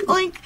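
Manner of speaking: spoken mock oink; pig oink. Teasing on the phone, mock pig oink. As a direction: play at being a piggy with an oink.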